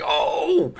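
high, sharp shocked oh; high startled exclamation; shocked gasp. Retold or imitated. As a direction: react like you just got burned. Reacting to Negan's Glenn line.

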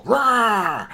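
loud, high mock roar of a walker; jump-scare roar; sudden haunted-house roar; zombie roar. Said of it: "you hear go".

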